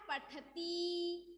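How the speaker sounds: पठती drawn out very slowly, each syllable apart; slow model word. Shown as a demonstration. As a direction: spell out पठती slowly for the pupils. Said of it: "तो क्या बन गया?".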